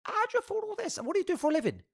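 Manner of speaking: very high-pitched, amazed questioning; incredulous questioning. Retold or imitated. Client asking psychic how she affords it, high mock amazement. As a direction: re-enact prying questions, voice pitched right up.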